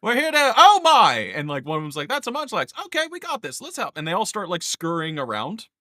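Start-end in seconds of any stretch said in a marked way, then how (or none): 0.0-1.3 loud, high-pitched Kiwi creature; Kiwi accent. Acted. Game master as little mushroom helpers arriving, loud high Kiwi accent. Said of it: "they all in a Kiwi accent go". 2.1-4.0 very high little Kiwi creature voice; Kiwi accent. Acted. Game master as mushroom people rallying to help, high Kiwi accent. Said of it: "they all in a Kiwi accent go".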